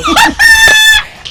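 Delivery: loud, high mocking cackle; gloating rival cackles, loud and high; mocking laugh.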